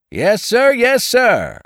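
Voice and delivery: high, loud hawker's call; raucous vendor cry. A narrator's reading. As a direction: holler it out like a street hawker. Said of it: "faint and raucous declarations of the vendors and hawkers".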